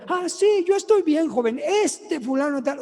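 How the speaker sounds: high-pitched, worked-up señora; high, indignant complaint; indignant woman. Retold or imitated. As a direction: imitate a feisty woman complaining. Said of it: "resulta que la señora era la que estaba agandallando".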